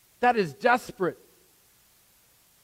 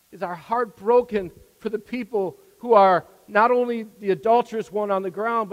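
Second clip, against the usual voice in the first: ordinary talk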